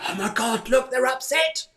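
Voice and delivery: high, surprised gasp of alarm; mock alarm at someone being upset; shocked onlookers. Onlookers suddenly noticing a friend is upset, high mock alarm. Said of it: "people go".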